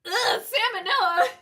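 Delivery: loud, high, grossed-out wail; disgusted cry. Real, not acted. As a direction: yell it in disgust. Disgusted at the character's face.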